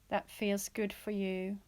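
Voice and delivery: ordinary talk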